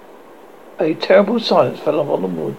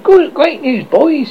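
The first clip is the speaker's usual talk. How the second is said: high, eager announcement; cried news; 10 semitones higher, 4 dB louder, slower than usual. A narrator's reading. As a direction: cry out the glad tidings. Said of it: "he cried".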